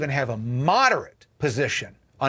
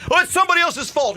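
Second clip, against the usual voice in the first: high, loud mocking whine; mocking whiner; 13 semitones higher, 5 dB louder, faster than usual. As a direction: sneer it in a whiny high voice.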